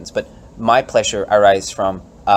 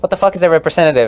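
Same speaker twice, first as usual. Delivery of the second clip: high, loud, fast baffled retort; mock bewildered voter; acted voter; 6 semitones higher, 4 dB louder, much faster than usual. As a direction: fire it back like a confused voter. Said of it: "And it's like".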